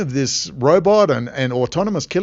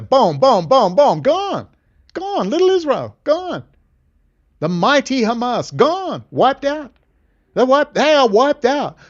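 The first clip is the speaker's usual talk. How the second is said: excited rising shout; 10 semitones higher than usual. Real, not acted. Building excited rant.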